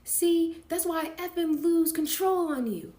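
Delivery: loud, high angry outburst; exploding. Acted. Sean exploding at Kim on the phone, shouting. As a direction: blow up at her in a high shout. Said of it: "Sean exploded".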